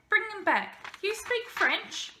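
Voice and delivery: high, loud order into a slow question; commanding, then surprised. A narrator's reading. Man recalls and questions the boy, commanding then surprised. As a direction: call back loudly, then question with fresh interest.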